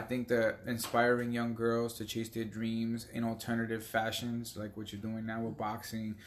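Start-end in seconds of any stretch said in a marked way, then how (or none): none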